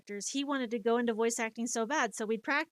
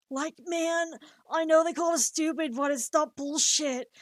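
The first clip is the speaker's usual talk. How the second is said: raised, put-on cartoon voice; improv character voice; 5 semitones higher, 4 dB louder than usual. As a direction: answer back in a higher cartoon voice. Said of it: "we would, like, practice together".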